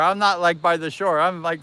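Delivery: normal talking voice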